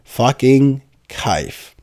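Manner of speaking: usual speaking voice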